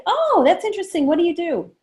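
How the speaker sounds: high, bright show of interest; interested reply. Shown as a demonstration. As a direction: sound delighted to hear it. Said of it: "I say".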